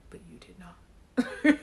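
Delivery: slow, teasing whisper; hushed, drawn-out playful correction; joking whisper. Pretend. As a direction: whisper it slowly as a mock put-down. Teasing aside, then says joking.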